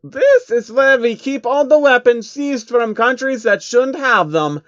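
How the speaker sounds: loud, lofty villain showing off his armory; Rothschild voice. Acted. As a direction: boast like a grand, smug banker villain. Rothschild character voice.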